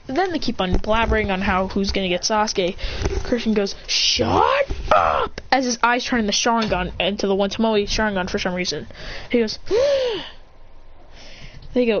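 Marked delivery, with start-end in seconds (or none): 3.9-5.4 low, drawn-out growled order; deep acted outburst; fed-up shut up. Character snaps at chatter. 9.6-10.3 high drawn-in gasp; acted high gasp; shocked gasp. Shocked by Sharingan.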